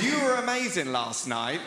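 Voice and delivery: slow, loud and emphatic; incredulous, heavily spelled-out quote; suggestive repeat. Retold or imitated. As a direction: draw out every word so the innuendo lands. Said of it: "So all that happened was".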